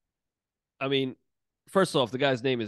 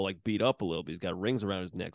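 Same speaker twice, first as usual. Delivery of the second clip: ordinary talk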